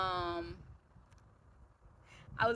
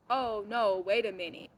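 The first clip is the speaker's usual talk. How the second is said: louder imitation of an alarmed friend; mock objection; 2 semitones higher, 6 dB louder than usual. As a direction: cut in like a worried friend, lightly acted. Friend voiced objecting to her journey.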